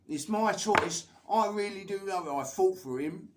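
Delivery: loud, high-pitched imitation of an indignant girlfriend; feisty. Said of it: "only time she ever gets feisty".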